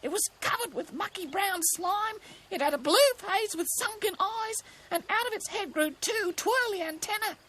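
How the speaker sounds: high, frightened kookaburra; spooked cartoon bird; shivering with fear. A narrator's reading. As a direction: describe the horror in a high scared bird voice. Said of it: "Cookie shivered".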